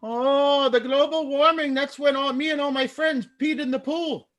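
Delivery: high-pitched, hammy bad German accent. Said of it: "My bad German accent".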